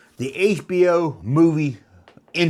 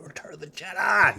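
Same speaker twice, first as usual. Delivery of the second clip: whispered quote; 5 semitones higher than usual. Retold or imitated. Imitating the phone recording, whispered. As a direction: breathe the title out like a hushed recording.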